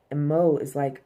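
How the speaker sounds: ordinary talk, nothing marked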